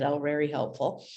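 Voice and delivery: ordinary delivery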